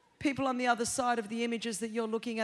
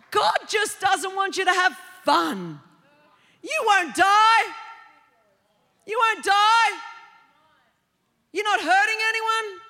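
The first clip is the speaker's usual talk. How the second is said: loud mock tempter; 5 semitones higher, 6 dB louder than usual. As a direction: shout the devil's taunt.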